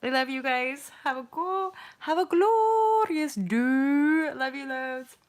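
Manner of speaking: high, loud sing-song farewell; effusive goodbye. Real, not acted. Streamer says goodbye to the viewers, high, loud and drawn out. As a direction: call it out high, stretching "glorious day" long.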